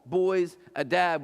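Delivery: usual speaking voice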